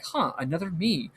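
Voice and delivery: mildly pensive, retold reaction; philosophical musing. Man learns of another version of himself, philosophical musing. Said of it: "he takes it very philosophically".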